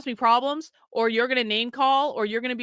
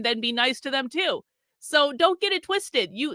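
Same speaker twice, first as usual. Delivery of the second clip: usual speaking voice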